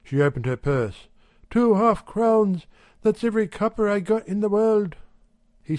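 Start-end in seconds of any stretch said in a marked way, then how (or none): 1.5-5.0 lightly higher wife's voice; a hint of a brisk housewife; Mrs Radford's voice. A narrator's reading. Wife telling the shopkeeper, lightly higher housewife voice.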